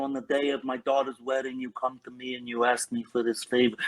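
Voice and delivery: a hint of the Godfather, lightly voiced; Marlon Brando Godfather. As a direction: ease into a light Godfather impression. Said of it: "So I would sort of do that".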